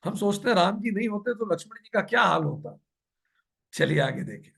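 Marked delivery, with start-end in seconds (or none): none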